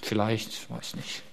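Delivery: faint, unsure mumble, trailing to nothing; doubtful mumble. Retold or imitated. Doubting believer answering the pastor, faint unsure mumble. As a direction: shrug it off half-heartedly under your breath.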